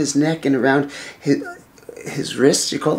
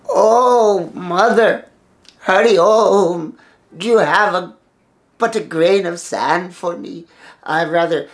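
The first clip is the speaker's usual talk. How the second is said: drawn-out, higher-pitched beggar's call; pitiful old man; 4 semitones higher, 4 dB louder than usual. Acted. Old man begs food from the divine mother, plaintive. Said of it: "pitiful old man with long matted hair".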